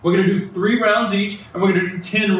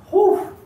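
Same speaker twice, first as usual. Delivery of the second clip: relieved whew. Real, not acted. Blowing out after hard reps.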